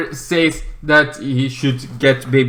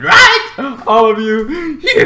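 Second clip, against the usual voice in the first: laughing; 11 semitones higher, 5 dB louder, much slower than usual. Real, not acted. Asks viewers, laughing.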